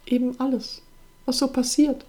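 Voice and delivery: high, sorrowful, helpless answer; sad, almost desperate. A narrator's reading. Sister overwhelmed by everything happening, sad and desperate. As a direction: answer helplessly, close to despair. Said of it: "klang jetzt traurig, fast verzweifelt".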